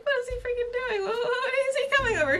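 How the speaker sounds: high-pitched panicky inner voice; flustered panic. Retold or imitated. Café-goer sees crush approaching, flustered high-pitched panic. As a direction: squeal it in rising alarm, half laughing.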